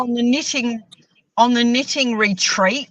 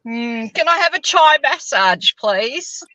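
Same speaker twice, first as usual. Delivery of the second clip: chai for tea, raised voice; imitating a friend's accent; mocking Neil's accent; 5 semitones higher, 4 dB louder than usual. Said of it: "he says".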